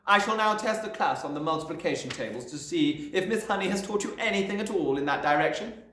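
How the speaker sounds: loud, high order; commanding headmistress. A narrator's reading. Headmistress ordering Miss Honey about, loud. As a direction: bark the command.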